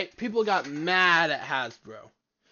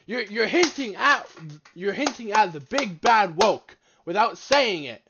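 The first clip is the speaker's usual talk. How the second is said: loud, high-pitched callout; exasperated accusation; 6 semitones higher, 7 dB louder than usual. Real, not acted. Viewer calling out the video's hints, exasperated. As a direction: hammer the key words, raised voice.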